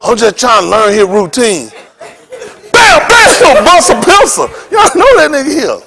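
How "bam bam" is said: high shouted bam bam noise; loud mock bang. Comedian acting out a stage trick, shouted bam. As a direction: belt out the banging sound.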